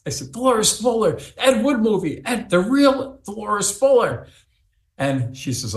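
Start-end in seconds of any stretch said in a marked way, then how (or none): 0.3-4.3 excited insisting. Retold or imitated. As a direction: drive home that it's really her. Insisting excitedly to his wife.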